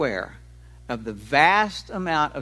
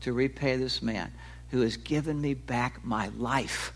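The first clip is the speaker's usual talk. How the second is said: hushed inner thought, softer; quiet, hushed; 5 semitones lower, 9 dB quieter than usual. Retold or imitated. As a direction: wonder it quietly to yourself. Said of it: "she's trying to think".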